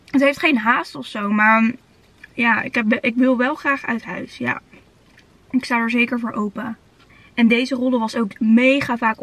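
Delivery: talking with food in mouth; eating between words; chewing while talking. Real, not acted. Seen 1: Bites nugget at -5, mouth keeps working while talking, food in hand.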